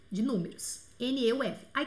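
ordinary talk

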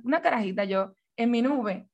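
usual speaking voice

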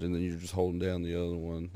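ordinary delivery

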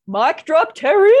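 loud, high-pitched whoop of triumph; delighted shout, building to the end; triumphant cheer. Retold or imitated. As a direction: call it out big, pitch climbing on the name. Cheering a retold comeback.

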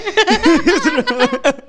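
real laughter. Real, not acted. Breaking character.

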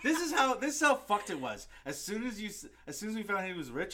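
high, loud excited telling; worked up. Real, not acted. Host telling how crazy the discovery was, worked up. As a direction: launch in loudly, still amazed.

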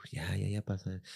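soft, low and gentle; calm it down softly; hushed soothing. Retold or imitated. Mom soothing the dying bird, low and hushed. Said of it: "le empezó a hablar bonito".

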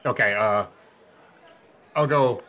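ordinary talk, nothing marked

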